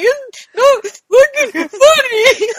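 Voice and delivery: very high, slow wail; mock tearful outrage. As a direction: bawl indignantly at those laughing at you. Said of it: "they're all laughing at me".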